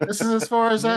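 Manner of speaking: high, tiny forest spirit voice; squeaky little spirit saying goodbye. Acted. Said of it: "you hear a little voice from behind you".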